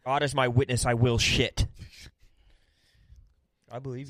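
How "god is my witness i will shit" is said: loud, laughing oath; hammed-up loud promise; mock solemn shout. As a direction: swear it loud like a vow, half joking.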